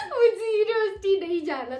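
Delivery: put-on a spoilt girl's petulant "मुझे university नहीं जाना"; whiny child. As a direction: whine like a kid begging mama. Whiny retold complaint to mother.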